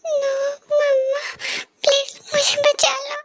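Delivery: high, tearful begging to mama; frightened child crying for mama; crying plea. Acted. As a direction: sob out the plea for help. Frightened child begs mother.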